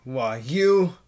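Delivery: loud, high-swinging exasperation; acted angry outburst. Gamer voices a character who stubbed a toe, angry outburst. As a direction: lash out, pitch leaping on the key word.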